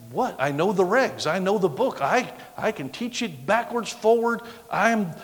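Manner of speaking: retold indignant boasting; cocky protest. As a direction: list your credentials, affronted. Retells his cocky protest.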